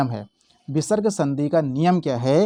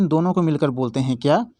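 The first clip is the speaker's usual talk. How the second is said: ordinary delivery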